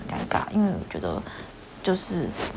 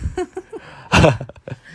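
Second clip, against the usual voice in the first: amused laugh; 2 semitones lower, 7 dB louder than usual. Real, not acted. Laughs at his playing dumb.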